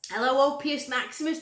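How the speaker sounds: ordinary delivery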